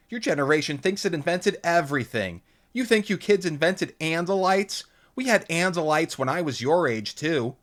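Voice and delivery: high, loud teasing mom; a mock-offended mother chiding; playful scolding mom voice; Lauren's mother. A narrator's reading. Mother teasing her daughter.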